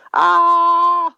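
short high shriek, loud; sudden scream. Retold or imitated. Woman screaming in the middle of the mall, loud high shriek. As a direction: let out one startled high yell. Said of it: "und schreit laut".

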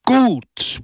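a single word bellowed high and loud; crowd roar. Acted. Angry villagers roaring approval at Pepone, loud and high. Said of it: "brüllte das Volk".

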